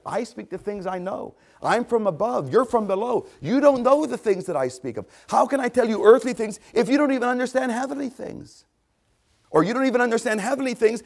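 high, insistent challenge; exasperated challenge. Acted. Preacher voicing Christ to those who don't understand, exasperated challenge.

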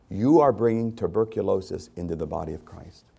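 ordinary talk, nothing marked

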